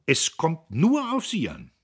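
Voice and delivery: loud, high, urging him on; forceful encouragement, pitched well up; encouraging. A narrator's reading. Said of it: "wiederholte Dieterich ermutigend".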